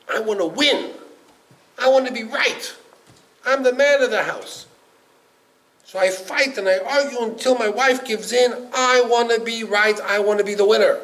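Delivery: husband telling the rabbi he must always win, insistent; insistent loud demand. Acted. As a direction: hammer home that he must be right.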